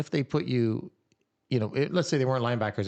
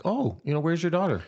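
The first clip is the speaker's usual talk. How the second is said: casual put-on "oh", pitched higher; feigned nonchalance; 6 semitones higher than usual. Suggesting how to ask the seatmate, feigned nonchalance. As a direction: toss it out lightly, higher than usual. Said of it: "do it kinda nonchalant".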